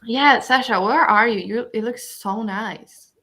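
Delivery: normal talking voice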